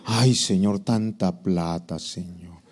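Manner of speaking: low, slow mock lament; hammed-up doleful prayer; reluctant tither praying. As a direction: groan to God over the money, fading away. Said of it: "Y cuando diezmamos".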